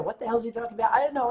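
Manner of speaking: normal talking voice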